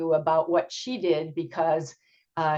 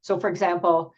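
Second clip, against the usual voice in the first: ordinary talk, nothing marked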